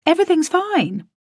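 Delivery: high, breezy cover-up; borrowed false reassurance. A narrator's reading. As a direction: insist brightly that nothing's wrong. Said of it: "borrowing from the master".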